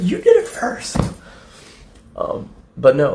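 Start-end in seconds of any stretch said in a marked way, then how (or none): 0.0-1.0 high, loud squawked retort; mock indignant yell. Retelling the fight with Kate, high indignant yell. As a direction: squeal it in hammed-up protest.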